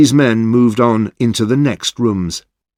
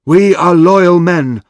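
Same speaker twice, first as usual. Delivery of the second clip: high, loud, solemn proclamation; formal declaration; 7 semitones higher, 4 dB louder than usual. A narrator's reading. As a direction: announce their loyalty with pride. Oishi formally addresses Kira.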